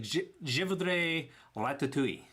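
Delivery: mangled tourist French, awkward and unsure; bad French accent. Tourist ordering ratatouille from a waiter, clumsy bad French accent. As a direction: stumble through the French order like a lost tourist. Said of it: "I don't understand your, your accent".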